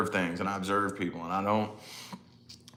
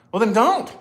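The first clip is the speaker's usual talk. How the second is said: high, loud flat advice; blunt retort; 13 semitones higher, 9 dB louder than usual. Retold or imitated. Telling daughter to skip the chat, loud and high. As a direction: fire it back bluntly. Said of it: "And I'm like".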